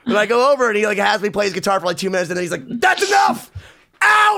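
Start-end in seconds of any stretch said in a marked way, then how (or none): 2.7-4.4 high, loud angry dismissal; hammed-up high-pitched bellow; imitated yell. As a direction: shout the kid out of the room. Imitates [name] yelling him out.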